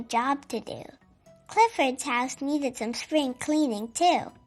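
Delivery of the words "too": usual speaking voice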